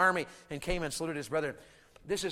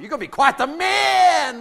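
raised, drawn-out sarcasm; sarcastic scolding; 7 semitones higher, 8 dB louder than usual. Retold or imitated. Father to his daughter's suitor, loud sarcasm. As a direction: scold him with mock admiration.

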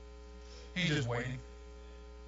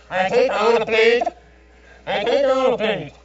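much higher and louder, tinny voice of a drive-thru box; drive-thru speaker voice; 9 semitones higher, 14 dB louder than usual. Retold or imitated. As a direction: mimic the squawking order speaker, repeating it. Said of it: "I hear the kids saying".

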